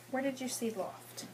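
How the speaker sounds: ordinary talk, nothing marked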